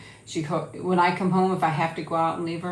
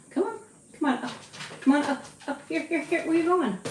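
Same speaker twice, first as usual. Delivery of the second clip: higher pet-talk to the dog; high coaxing; 10 semitones higher than usual. Real, not acted. Coaxing Nikki up in a high pet voice. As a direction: lure her up in a bright little voice.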